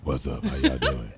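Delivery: low, cool playful greeting; deep-voiced cartoon mouse. Guest playing along as a little cartoon mouse, deep voice. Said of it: "have you be like".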